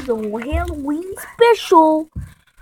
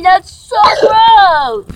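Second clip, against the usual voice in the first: slow, whiny fake sob; mock crying; 5 semitones higher, 8 dB louder, much slower than usual. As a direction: drag it out, loud mock sob. Friend coughing on screen.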